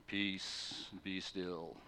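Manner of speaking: soft, low, hushed "Peace, be still"; calm. Shown as a demonstration. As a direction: say it calmly, almost under the breath. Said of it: "Jesus didn't calmly get up and just say".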